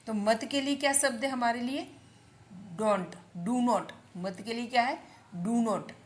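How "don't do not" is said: drawn-out, separated teaching words; slow model. Shown as a demonstration. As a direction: say it slowly for learners. Teacher slowly models the key word. (धीरे-धीरे, साफ़ बोलकर सिखाते हुए)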